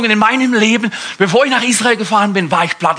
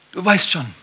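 sly devil voice; 3 dB quieter than usual. Acted. Teufel flüstert die Andeutung.

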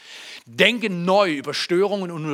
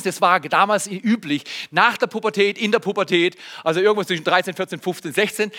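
ordinary delivery

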